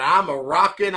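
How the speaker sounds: ordinary talk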